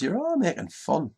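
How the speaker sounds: the owner complaining that they mock him; protesting owner. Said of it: "But the owner says".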